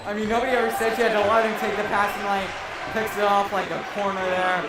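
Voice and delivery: loud and fast hyped commentary; excited. Real, not acted. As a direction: ride the high of the big play. Color man hyped after steal.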